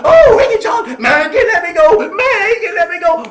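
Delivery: high, loud crying plea; hollering. Acted. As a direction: wail to be set free. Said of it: "he's hollering out".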